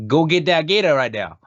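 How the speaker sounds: high, thick mock-Cajun twang; Cajun accent. Shown as a demonstration. Roommate teaching a practice phrase, high Cajun accent. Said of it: "Cajun accent".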